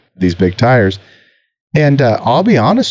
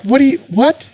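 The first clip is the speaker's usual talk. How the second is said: baffled. Retold or imitated. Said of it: "And I'm like".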